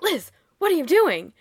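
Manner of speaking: high, loud "Liz, what are you doing?"; mock shout. Recapper acting jealous Kyle confronting Liz, mock shout. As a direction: yell it like a jealous boyfriend catching them.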